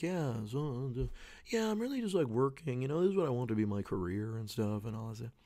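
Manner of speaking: high, airy mock of a self-important twenty-something; mocking party small talk. A young man at a party talking up his career, mock airy voice. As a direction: send up the guy bragging about his career at a party. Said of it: "you'd be like".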